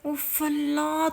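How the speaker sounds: very slow, soft "اف Allah" of dismay; drawn-out despairing groan of a mother; exasperated sigh. Acted. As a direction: let it out like a weary mother. Mother shocked by daughter's cheek.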